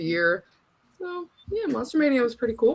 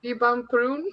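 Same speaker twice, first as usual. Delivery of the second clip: Spanish accent; 3 semitones higher than usual. Shown as a demonstration. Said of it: "Say that one more time".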